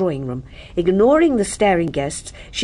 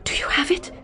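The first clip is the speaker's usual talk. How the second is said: breathless, urgent whispered question; eager whisper; 9 semitones higher, 4 dB quieter than usual. Acted. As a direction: blurt it out in a hushed rush. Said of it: "she burst out".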